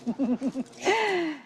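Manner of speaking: light, amused little laugh. Real, not acted. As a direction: laugh softly at the interruption. Amused by dogs barking.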